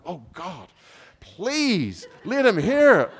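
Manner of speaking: slow, high pleading to God; pleading prayer. Retold or imitated. Man in his office begging God, slow high plea. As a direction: stretch the plea out high and longing.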